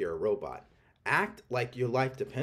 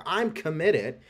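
mock excited; 5 semitones higher, 4 dB louder, slower than usual. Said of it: "when you're excited about something".

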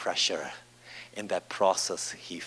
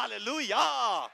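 exultant hallelujah; 11 semitones higher than usual. Real, not acted. Preacher praising before the congregation, exultant. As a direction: let the praise ring out high.

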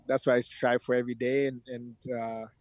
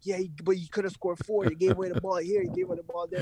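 dad's voice, higher and picky; critical dad; 6 semitones higher, faster than usual. Retold or imitated. Father critiquing his son's game tape, mildly mock-stern. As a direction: list the mistakes like a strict father. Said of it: "He's like".

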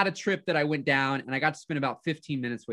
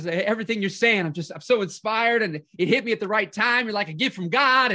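imitated driver gushing, higher and louder; all fired up; 4 semitones higher, 4 dB louder than usual. Driver to his passenger, excitedly inspired. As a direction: gush like an inspired fan, pitch lifted. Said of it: "he's getting all fired up".

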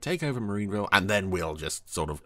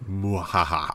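hammed-up villain cackle; loud theatrical mwahaha; evil laugh; 2 semitones lower, 7 dB louder, slower than usual. Commentator hams up a villain laugh.

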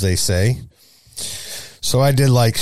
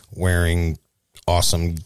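usual speaking voice

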